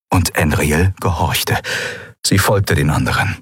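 ordinary delivery, nothing marked

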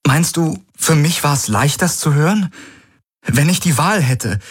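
put-on high young woman's voice, hurt and incredulous; Key, hurt and pleading. Key asks if he thinks this is easy, hurt and high. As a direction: plead with him, voice lifted high.

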